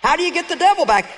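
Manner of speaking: usual speaking voice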